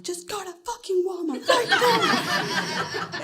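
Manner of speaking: high, drawn-out mock yell; exasperated demand, slow and high without full volume; retold yell. Wife ends argument with husband over milk replacer, mock yelling. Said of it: "I just yell at him".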